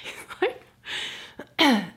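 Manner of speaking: normal talking voice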